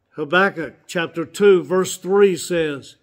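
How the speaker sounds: ordinary delivery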